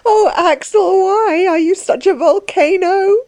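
high, halting, sobbing lament; weeping reproach, pitched high; tearful crying. Acted. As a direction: cry it out high, breaking off between phrases. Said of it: "she cried, looking up at him, the tears rolling".

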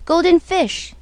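high calling voice across the waves. Acted. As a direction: call the fish from the shore. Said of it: "he called".

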